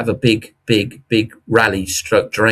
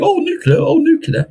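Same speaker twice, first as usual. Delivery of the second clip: much higher, swooning mock gush; gushing media. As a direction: coo over the word like a fan. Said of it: "everyone's saying".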